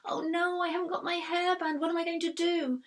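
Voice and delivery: put-on high, upset little girl; fretting young girl, near tears; panicky girl voice. A narrator's reading. Poppy panicking about hairband.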